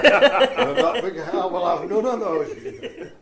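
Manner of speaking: slow words through laughter; laughing. Real, not acted. Answering the host about marriage, laughing.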